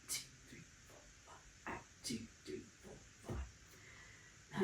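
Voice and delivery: very soft, breath-only counting; whispered count. Shown as a demonstration. Pilates instructor counting the held curl, whispered. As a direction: count on the breath, barely any voice.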